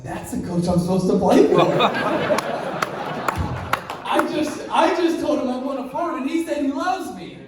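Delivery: high, laughing, stunned rambling; laughing disbelief. Retold or imitated. After the call, telling his dad through laughter. Realizing he chose wrong coach.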